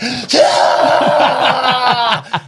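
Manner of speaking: long high battle scream; mock war cry. Storyteller acting out his naked charge, screaming high. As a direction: let out a drawn-out charging yell.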